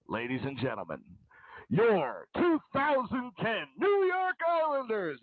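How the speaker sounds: slow, booming arena announcer voice; echoing stadium announcer. Retold or imitated. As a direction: stretch it out like the PA over the ice. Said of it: "the P A announcer says".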